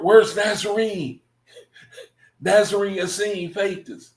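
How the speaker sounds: high, fast, desperate imitation of a jailed man asking around; frantic mock plea. Mocks jailed people begging for contacts.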